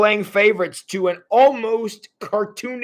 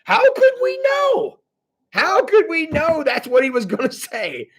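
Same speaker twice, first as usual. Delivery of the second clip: exasperated protest; 9 semitones higher than usual. Acted. Brothers defend themselves to Dad, exasperated protest. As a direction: protest innocence in a raised voice.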